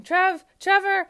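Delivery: high, loud call of a name; mock shouting. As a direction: call out as if to a friend up ahead.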